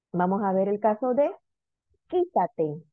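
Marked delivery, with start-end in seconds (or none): 2.1-2.8 quítate with weight on first QUÍ; stress on antepenultimate syllable. Shown as a demonstration. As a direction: lean on QUÍ, let tate fall in quítate. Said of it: "Tercera sílaba. Antepenúltima".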